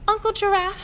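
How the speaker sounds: put-on high, eager little child voice; little elephant asking her aunt; curious elephant child. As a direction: pipe it up like a small curious calf.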